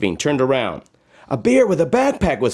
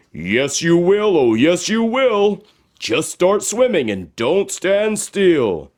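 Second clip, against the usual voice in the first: higher, sing-song voice urging her on; magic shoes encouraging; 6 semitones higher than usual. Acted. Talking shoes urging Josephine to climb, bouncy and higher. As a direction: cheer her up the hill. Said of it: "came the voices again".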